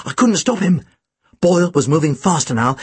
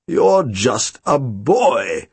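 low, very slow auctioneer voice; ponderous disbelief, pitched low; exclaiming auctioneer; 5 semitones lower, much slower than usual. A narrator's reading. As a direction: exclaim it slowly in a lowered, drawn-out voice. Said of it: "the auctioneer exclaimed".

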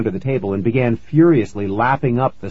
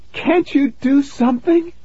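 very high, distressed mother's voice; panicked mom. A narrator's reading. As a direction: plead frantically in a high head voice. Panicked Mom pleading with Dad.

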